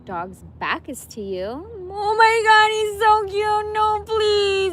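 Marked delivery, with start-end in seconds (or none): 1.9-4.7 squealing. Real, not acted. Gushing over the cute animal again, loud squeal. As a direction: squeal with helpless delight.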